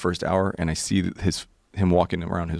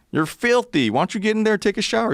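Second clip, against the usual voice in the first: much higher, fast, insistent mock cowboy; pushy cowboy; 3 dB louder than usual. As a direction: push Brian into the shower, hammed up. Cowboy urging friend to shower.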